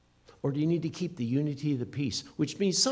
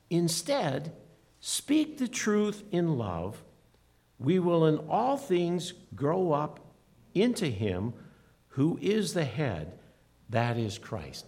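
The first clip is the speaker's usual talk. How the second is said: slow, measured reading of the verse; deliberate verse recitation; scripture reading; 2 semitones higher, slower than usual. Acted. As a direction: lean on each phrase as holy words. Reads scripture verse aloud.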